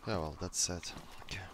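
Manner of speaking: soft unvoiced whisper. Real, not acted. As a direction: whisper it under your breath. Whispered aside.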